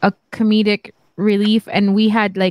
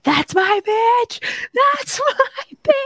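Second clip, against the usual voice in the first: high, drawn-out squealing shout; re-enacted fan cheer; 13 semitones higher, much slower than usual. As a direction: cheer your idol in a stretched, near-tearful squeal.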